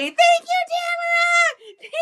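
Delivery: very high, loud dog voice; voicing the dog. The dog thanking Tamara for the treat, very high loud voice.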